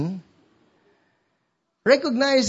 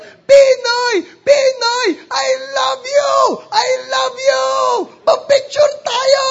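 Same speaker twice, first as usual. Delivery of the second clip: high-pitched, full-out fangirl screaming; imitated fan shouting; 7 dB louder than usual. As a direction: scream pleadingly over a crowd at your idol. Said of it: "So she was shouting".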